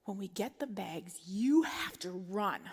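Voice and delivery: slow, deliberate whisper; brief the kids like a secret mission; hushed, drawn-out parental order; intense whisper. Retold or imitated.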